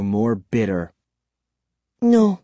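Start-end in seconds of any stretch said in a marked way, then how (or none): 2.0-2.4 high, tearful denial; lightly choked, high cry. A narrator's reading. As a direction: force it out, upset. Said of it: "she choked out".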